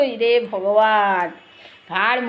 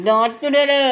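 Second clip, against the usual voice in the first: normal talking voice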